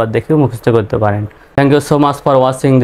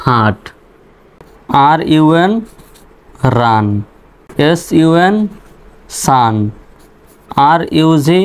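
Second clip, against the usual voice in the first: ordinary talk, nothing marked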